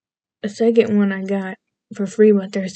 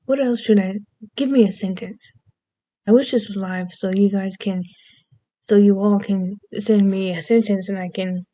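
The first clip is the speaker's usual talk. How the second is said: usual speaking voice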